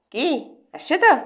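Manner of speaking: ordinary delivery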